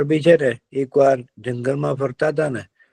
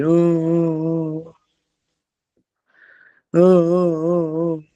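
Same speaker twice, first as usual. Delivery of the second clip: high, drawn-out mock boo-hoo; fox's fake crying; 5 semitones higher, much slower than usual. Fox pretends to cry to trap the deer, mock wailing. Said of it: "શિયાળભાઈ રડવા માંડે છે".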